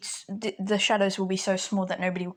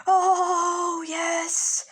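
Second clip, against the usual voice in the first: starstruck fan; 10 semitones higher, 4 dB louder than usual. Acted. Fan overjoyed meeting hero.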